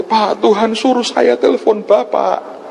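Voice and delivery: high, slow pleading voice; imitated woman caller. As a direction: draw out an earnest plea in a high voice. (menirukan ibu penelepon, tinggi dan lambat)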